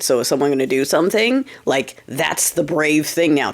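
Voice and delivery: normal talking voice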